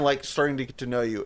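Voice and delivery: ordinary talk, nothing marked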